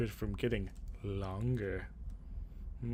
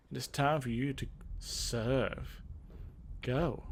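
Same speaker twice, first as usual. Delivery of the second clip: ordinary talk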